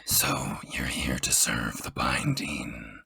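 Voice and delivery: deep hushed whisper, slow; low whispered character voice. Acted. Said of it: "that was meant to be a question".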